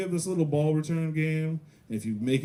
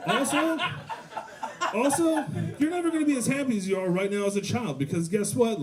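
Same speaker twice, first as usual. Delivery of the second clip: mock pep talk turning manic; 6 semitones higher than usual. Comedian to crying kid, manic mock cheer. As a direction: build up to cheery cruelty.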